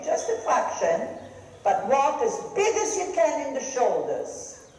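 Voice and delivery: ordinary talk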